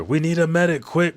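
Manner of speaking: high, loud cry for help; urgent call for a medic. Retold or imitated. Brook calls for help over his dying king, urgent shout. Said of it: "Brook says".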